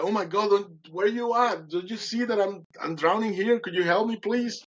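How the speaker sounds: high, pleading cry for help; frantic high-pitched pleading; desperate plea. Retold or imitated. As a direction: beg the voice to see you drowning. Desperate plea while drowning.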